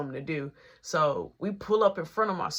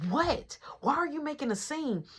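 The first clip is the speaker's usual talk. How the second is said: high-pitched retold indignation; indignant protest; 10 semitones higher than usual. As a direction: act out an affronted girl, pitched high. Confronted girl protests at exit.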